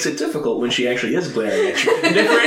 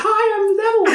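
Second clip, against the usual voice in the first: very high squeaky cartoon voice, laughing; Mickey Mouse devil; 4 dB louder than usual. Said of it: "It's Mickey Mouse".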